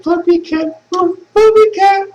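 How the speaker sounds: very high, squeaky voice for his cat; high squeaky voice speaking for the cat. Retold or imitated. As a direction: coo it like a tiny pet talking. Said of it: "and he's like".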